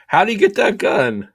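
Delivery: high, loud, laughing question; laughing mock outcry. Co-host joins the joke, laughing.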